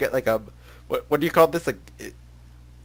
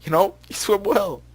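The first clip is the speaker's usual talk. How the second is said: put-on girl character voice; 7 semitones higher, 4 dB louder than usual. Voicing Alyssa in visual novel.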